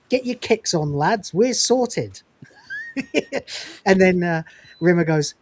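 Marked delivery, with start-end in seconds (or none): 0.1-2.2 mock Mancunian lad, rising in pitch; cheeky Mancunian lad voice, pitched up; Mancunian slang. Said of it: "they do Mancunian accents".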